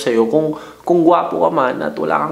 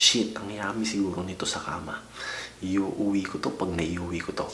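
lustful aside; 6 semitones lower, 12 dB quieter than usual. Retold or imitated. Imagined lustful inner thought.

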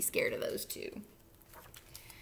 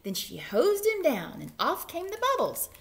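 ordinary delivery, nothing marked

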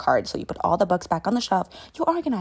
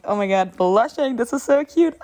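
rising, louder giddy squeal; giddy gushing; 4 semitones higher, 9 dB louder than usual. Pretend. Girl reacts to his phone number with a giddy rising squeal. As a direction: get giddier with every word. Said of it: "And you're like".

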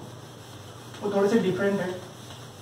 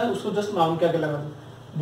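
slower, spelled-out restatement of the rule; slow emphatic repeat; slower than usual. Shown as a demonstration. Teacher repeating the rule to students, slowly. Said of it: "क्या?".

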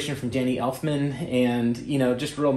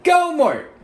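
high loud squeaky shout; squeaky hype voice; 8 dB louder, much slower than usual. Acted. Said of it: "We got male rapper vocals".

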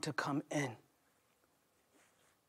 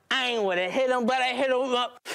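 rapper mumbling to himself; 5 semitones higher than usual. Retold or imitated. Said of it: "[name] saying to himself".

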